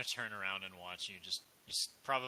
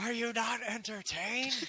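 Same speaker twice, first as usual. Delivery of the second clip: slow, high, booming challenge; gladiator shout; 12 semitones higher, slower than usual. Retold or imitated. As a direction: roar it to the crowd. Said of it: "quoting the movie line".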